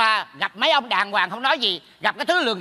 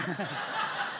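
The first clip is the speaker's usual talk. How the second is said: very soft, voiceless chuckle; teasing laugh; 8 semitones lower, 14 dB quieter than usual. Acted. Neighbour revealing an embarrassing secret, hushed teasing laugh. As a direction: let out a quiet, teasing chuckle.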